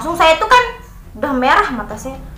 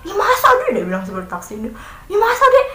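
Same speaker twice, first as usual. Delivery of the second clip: surprised taxi driver; 8 semitones higher, faster than usual. Retold or imitated. Taxi driver reacts to the story.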